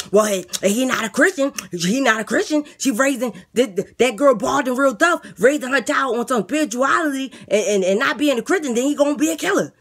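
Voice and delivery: eager inmate talking up his plan; lisping boastful inmate. Acted. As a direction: beg the guard like a cocky jailbird. Inmate rants about blogger.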